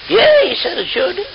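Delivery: put-on high, wobbly drunk king voice; drunk old king.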